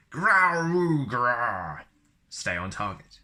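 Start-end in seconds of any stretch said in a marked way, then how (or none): 0.1-1.8 loud, high mock Wookiee growl. Shown as a demonstration. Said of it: "Want to play holochess?".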